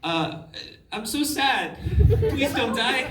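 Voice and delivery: high, loud hammed-up grief; mock-sad acting. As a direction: overact a tearful plea in a high voice. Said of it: "You're very sad".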